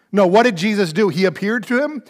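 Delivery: ordinary delivery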